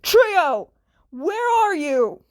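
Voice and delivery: loud urgent call; shout. Acted. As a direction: yell for her across the office. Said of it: "he shouted".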